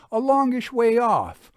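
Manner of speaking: lightly voiced country answer; vague villager. A narrator's reading. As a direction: shrug it off like a cheerful local.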